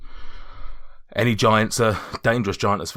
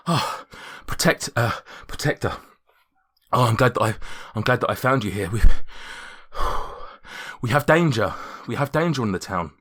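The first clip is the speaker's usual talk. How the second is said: out of breath; 3 semitones higher, 4 dB louder than usual. Acted. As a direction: gasp it out like a boy who just ran to town. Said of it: "I'm out of breath just pretending to be the guy that's run there".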